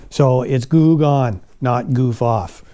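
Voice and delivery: "goo gone" dan "goof off" diucapkan pelan dan jelas; slow emphatic correction. Shown as a demonstration. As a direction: stress both names slowly. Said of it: "I didn't say goof off. I said goo gone".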